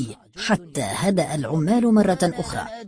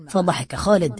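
plain reading voice

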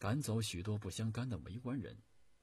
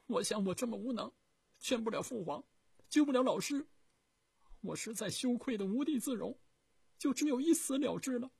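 put-on high, loud, sobbing young voice; grief-stricken; 8 dB louder than usual. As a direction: pour out his shame in tears. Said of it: "悲痛地说".

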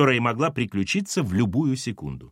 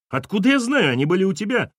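high heated retort; quarrel; 8 semitones higher, 4 dB louder than usual. A narrator's reading. Ex-wife arguing about keys, high and heated. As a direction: snap back in the argument.

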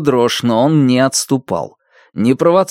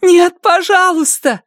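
high, slow desperate plea; tearful plea; 4 dB louder than usual. Acted. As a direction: beg through tears. Crying girl pleads.

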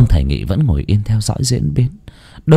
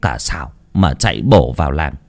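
plain reading voice

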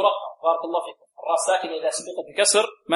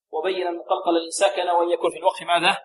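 ordinary delivery

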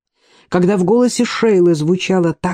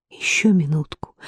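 whisper; 3 dB quieter than usual. A narrator's reading. Soft reply at night window.

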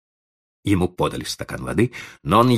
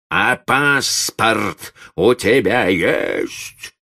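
slow, raised, threatening bellow; menacing roar; 5 semitones higher, 3 dB louder, much slower than usual. A narrator's reading. Doctor suddenly challenging the old man, roaring. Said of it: "вдруг грозно взревел доктор".

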